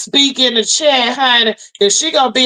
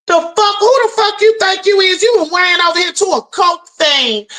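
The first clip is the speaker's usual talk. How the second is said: angry shout; 6 semitones higher than usual. Real, not acted. Vlogger yelling at the rival who tried to snitch, furious. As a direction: tear into the rival at full volume.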